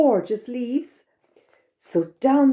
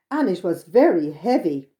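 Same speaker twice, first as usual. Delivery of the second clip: usual speaking voice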